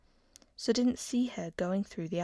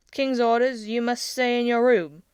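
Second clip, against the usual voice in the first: firm guard's command, louder; stern guard; 4 semitones higher, 9 dB louder than usual. A narrator's reading. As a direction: lay down the king's orders. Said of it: "one of them said".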